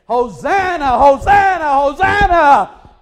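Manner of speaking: loud hosanna shouts, rising to a cry; hollering crowd. Retold or imitated. Preacher voicing the crowd greeting Jesus, jubilant shouts.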